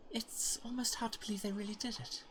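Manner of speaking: awed, breathless whisper; hushed disbelieving hero; whispered character voice. Acted. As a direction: marvel at it under your breath. Voicing game character.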